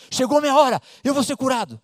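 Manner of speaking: exclamação alta e animada, repetindo; empolgada. Acted. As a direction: grite de alegria, chegou a hora. (agora com força, empolgada)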